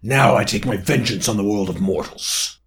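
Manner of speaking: 不気味な魔物の声; menacing demon. Acted. Demon threatens.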